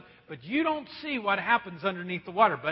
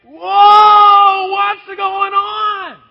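high, very loud, stretched-out shout of alarm; panicked fish; 11 semitones higher, 21 dB louder, much slower than usual. Acted. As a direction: cry out like a fish feeling the hook. Fish suddenly hooked.

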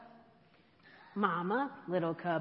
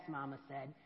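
usual speaking voice

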